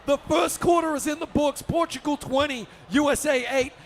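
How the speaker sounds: loud, high play-by-play; excited buzzer-beater call. Real, not acted. Commentator calls a buzzer beater, excited shout. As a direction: shout the basket, then proclaim the score.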